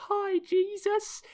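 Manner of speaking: put-on sing-song little kid greeting a hero; kid meme voice. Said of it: "that meme where it's the kid goes".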